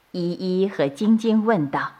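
ordinary delivery, nothing marked

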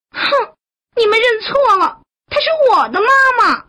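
put-on very high, indignant child voice; huff, then protest fast and high; proud little turtle. A narrator's reading. Little turtle claiming its mother, high indignation. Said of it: "昂着头说".

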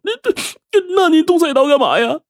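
very high, stammering fear; a tearful son asks his father why he holds the cleaver; tearful question. Acted. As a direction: ask through tears, still shaken.

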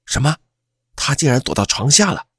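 taken aback, incredulous; astonished. A narrator's reading. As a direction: react in amazement, voice lifting. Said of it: "冯刚惊讶地说".

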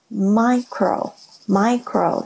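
"micro" slow, with a long "ai" sound; teacher modelling "micro" slowly. Shown as a demonstration. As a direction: draw out the "ai" in "micro". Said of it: "它那个I是发I的声音".

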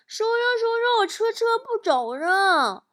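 put-on high-pitched childish whine, drawn out at the end; little boy. Little boy tells his uncle the toy car stopped, childish whine. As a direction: plead in a small child's high voice. Small nephew runs to uncle.

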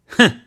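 sharp high loud scoffing humph; scornful humph. A narrator's reading. Magistrate scoffs at the lying clerks with a loud scornful humph. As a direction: snort out contempt, high and loud.

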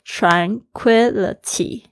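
"tranquility" stretched slowly, syllable by syllable; drawn-out "tranquility" for learners; slow teaching repeat of "tranquility"; slow model word. Shown as a demonstration. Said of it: "还有tranquility".

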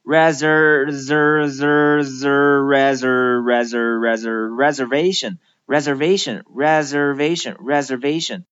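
"reser-" with the tongue curled back on the "er"; chanted syllable drill, R-coloured "er" in "reservation". Shown as a demonstration. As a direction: drill "zer" with a strong R, building to "reservation". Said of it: "一定要将舌头往后卷一卷".

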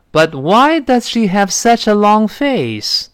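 slow model question with strong "why"; stressed why question. Shown as a demonstration. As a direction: hit "why", let "does she" go by lightly. Acts out puzzled question in dialogue.

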